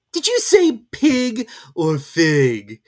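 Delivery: high, loud, slow teasing cat; grinning cat. A narrator's reading. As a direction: tease her sweetly. Cheshire Cat voiced.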